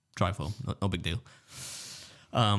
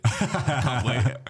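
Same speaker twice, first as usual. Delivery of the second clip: laughing; 4 semitones higher, 4 dB louder, much slower than usual. Retold or imitated. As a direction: say it through a breaking laugh. Jokes sarcastically, breaking into laughter.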